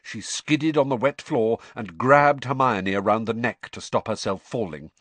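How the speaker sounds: ordinary delivery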